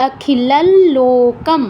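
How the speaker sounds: "অখিলাল লোকম" slowly, with a long nasal half ল between the words; slow nasal-l demo. Shown as a demonstration. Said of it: "তাই ল অর্ধেক উচ্চারণ করছি". ("অখিলাল লোকম", ধীরে, অর্ধেক ল নাকে টেনে)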